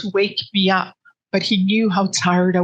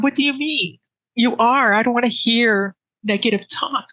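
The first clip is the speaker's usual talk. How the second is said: higher, pleading retold protest, fighting off bad news; upset protest; 5 semitones higher than usual. Wife refusing to hear her husband say goodbye, high pleading protest. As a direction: insist he will make it, voice pitched up.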